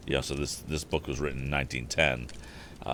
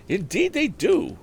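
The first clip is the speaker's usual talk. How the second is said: high, slow, emphatic woman's voice; a farm wife's firm agreement, much higher; asserting farm wife; 4 dB louder, slower than usual. A narrator's reading. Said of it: "asserted Missus Blackford".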